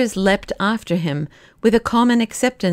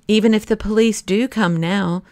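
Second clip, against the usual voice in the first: ordinary delivery, nothing marked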